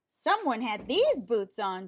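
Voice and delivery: Mama Bear complains about her boots, huffing; huffy Mama Bear. A narrator's reading. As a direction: huff at it like an annoyed mother bear. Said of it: "she huffed".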